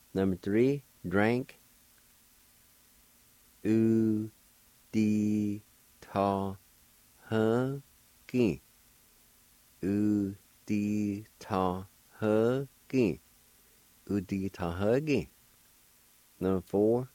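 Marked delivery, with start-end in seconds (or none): 3.6-8.6 U, di, ta, ha, ki, each held alone; long pauses between slightly raised syllables; slow teaching demo; syllable-by-syllable pronunciation. Shown as a demonstration. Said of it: "I'll pronounce these words". 9.8-13.2 U di ta ha ki drawn out syllable by syllable; measured teaching pronunciation; slow syllable demo. Shown as a demonstration. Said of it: "now I'll pronounce these words".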